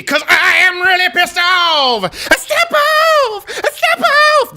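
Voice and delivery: high, strained furious yell; angry shout. Acted. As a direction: explode with mock rage. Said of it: "That's how angry I am".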